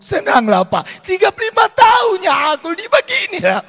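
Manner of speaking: a wife wailing about thirty-five years unhugged; old wife's lament. Retold or imitated. Pastor voices Batak wife complaining to pastor, high loud wail.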